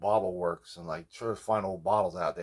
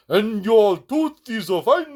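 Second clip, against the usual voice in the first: higher imitation of a Trinidadian accent; 11 semitones higher, 8 dB louder, slower than usual. A patient imitates his Trinidadian dentist's accent. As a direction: mimic the Trinidadian dentist's lilt. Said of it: "this woman from Trinidad".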